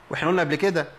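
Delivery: usual speaking voice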